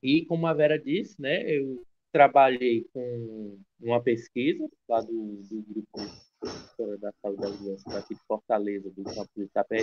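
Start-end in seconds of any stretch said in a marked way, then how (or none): 5.0-9.8 low, soft voice sinking away; voz baixinha. Real, not acted. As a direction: speak too softly for the room to hear. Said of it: "Tá baixinho". (falando baixinho)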